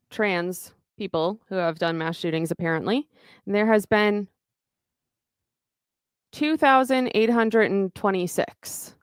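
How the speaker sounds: ordinary talk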